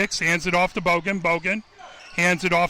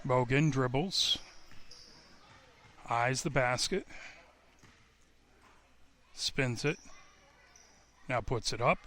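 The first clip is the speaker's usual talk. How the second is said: much lower, much softer, near murmur; hushed call; 7 semitones lower, 11 dB quieter than usual. Real, not acted. Commentator hushed during the free throw, quiet. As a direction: drop to a hush while she shoots.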